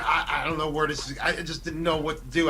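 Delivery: usual speaking voice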